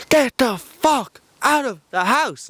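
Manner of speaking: high, drawn-out retold scream. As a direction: imitate two men screaming, slow and high. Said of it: "we both start screaming".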